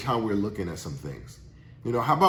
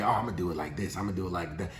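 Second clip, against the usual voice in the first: sly corner-cutter; 9 semitones lower, 9 dB quieter, faster than usual. Retold or imitated. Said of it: "cutting corners".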